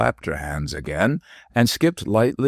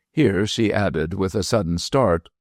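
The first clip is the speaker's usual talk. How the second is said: ordinary delivery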